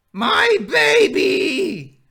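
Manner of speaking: very high, drawn-out screech; ghost's scream. Acted. Ghost of a woman screaming for her baby, long piercing screech. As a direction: shriek it at full volume, stretching each word. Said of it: "as she's screaming".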